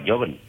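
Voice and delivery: «joven» empezando con Y; pronunciación antigua. Shown as a demonstration. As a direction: begin «joven» with a Y sound. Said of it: "se pronunciaba". («joven» dicho «yoven», a la antigua)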